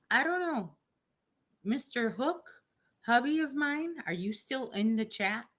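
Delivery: high, bright call to hubby. Real, not acted. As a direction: pitch up and call out to him. Calling out to husband in chat.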